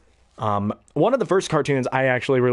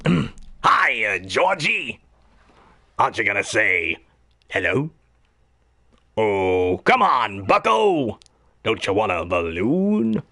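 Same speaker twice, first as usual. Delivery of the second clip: creepy clown coaxing; swooping, sinister clown voice; Pennywise; slower than usual. Acted. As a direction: lure a child with drawn-out words. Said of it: "Now, Pennywise".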